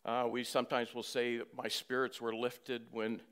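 loud, driving sermon voice; full-force preaching. Acted. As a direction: preach it hard to the congregation.

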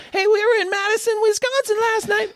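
hammed-up high frontman, quick; same stage banter in the next city. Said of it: "he does the exact same thing".

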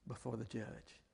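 low, fading almost to a whisper; hushed plea. Retold or imitated. Mother asking for prayer before the custody hearing, hushed plea. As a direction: imitate her humble request, barely voiced. Woman's anxious request for prayer.

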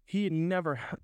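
ordinary delivery, nothing marked

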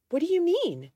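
sharp jump up in surprise; startled question. A narrator's reading. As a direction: blurt it out, taken aback. Startled by his revelation.

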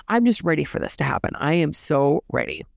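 ordinary talk

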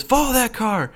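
high-pitched urgent movie command; Frank Drebin. Retold or imitated. Cop jumping into a car, mock urgent order. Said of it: "and he goes".

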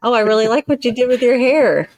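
high, sugary fake praise; mock-sweet compliment. Shown as a demonstration. A counselor demonstrates a hollow compliment to her listener, mock-sweet. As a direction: gush so it rings false. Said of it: "two totally different things".

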